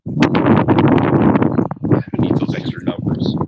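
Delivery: whispered aside. Real, not acted. Reader muttering a joke to viewers, whispered. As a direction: breathe it out as a sly aside.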